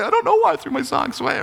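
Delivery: put-on high, fast kid protest; defensive child. Child answering a parent about lost socks, high defensive whine.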